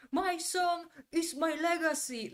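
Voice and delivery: high, loud, hammered-out mock shout; imitating an actor's unvaried yell; machine-gun yelling. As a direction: fire the words off like a machine gun. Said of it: "like a machine gun".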